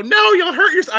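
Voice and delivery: wife protesting as he lifts her; imitating wife. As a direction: squeak it like his worried wife. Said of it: "and she'll go".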